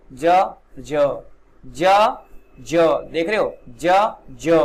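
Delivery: ज said loudly, alternating a hard j and a buzzing z; j versus z sounds. Shown as a demonstration. Teacher contrasts the two ज sounds aloud. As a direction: contrast ज as j and ज़ as z, each one punched. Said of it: "दोनों में फर्क है बोलने में".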